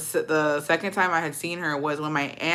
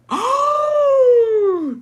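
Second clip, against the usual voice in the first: long, high, loud mock gasp; shocked gasp; 6 dB louder than usual. Reacting to grandma's gorditas remark, huge mock gasp. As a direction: gasp hugely, scandalized.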